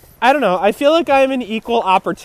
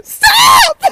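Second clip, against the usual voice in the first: shrieking high through laughter; cracking up, yelling stop; laughing shout; 8 dB louder than usual. Real, not acted.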